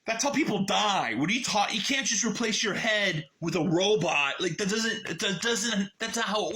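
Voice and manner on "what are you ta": usual speaking voice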